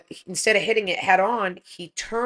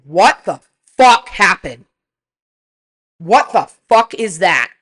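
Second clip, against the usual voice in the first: stern demand; 9 dB louder than usual. Retold or imitated. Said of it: "but I was very stern".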